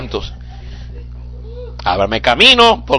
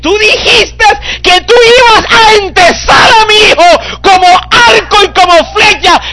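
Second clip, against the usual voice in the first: crying shout, much higher and louder; a tearful preacher's cry at full power; preaching at full force; 8 dB louder than usual. Acted. (predicación a gritos, con llanto)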